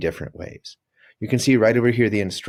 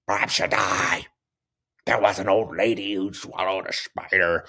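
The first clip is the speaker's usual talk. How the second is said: pirate voice; 2 semitones lower than usual. Shown as a demonstration. Teacher reading the poem to pupils, lightly pirate-voiced. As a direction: read the poem like a hammed-up pirate. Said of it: "the pirate way".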